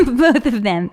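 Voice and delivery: laughing. Real, not acted. Teasing about two listeners.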